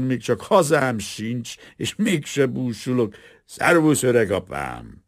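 booze-happy, sloppy cheer; drunk worker. A narrator's reading. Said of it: "Az ácslegény részegen ölelgette". (részeg, hangos, vidám ácslegény)

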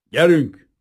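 loud, high barked order; growled command. A narrator's reading. Soviet guard growls at the prince, loud barked order. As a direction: snap the order at the prisoner's guide. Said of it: "mordult rá a fickó".

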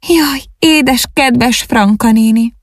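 high-pitched, drawn-out wail of grief; bitter lament. A narrator's reading. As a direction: mourn her in a plaintive high voice. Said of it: "zokogásba fakadt, s keservesen siratta". (keserves, magas hangú siránkozás)

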